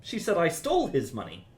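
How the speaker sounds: "stole" punched high; stress on STOLE. Shown as a demonstration. Teacher shows stress to the composer, punching "stole". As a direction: lean hard on "stole". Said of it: "depending on how you emphasize".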